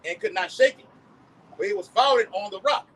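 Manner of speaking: slow, loud preaching read; scripture reading. Acted. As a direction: proclaim the verse slowly. Reads scripture aloud.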